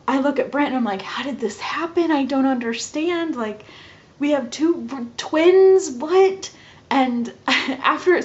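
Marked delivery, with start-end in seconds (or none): 1.0-6.5 high bewildered questioning, peaking loud on twins; incredulous, building to a high, loud what; shocked disbelief. Retold or imitated. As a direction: re-enact baffled shock to a partner. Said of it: "everything about it has been a shock".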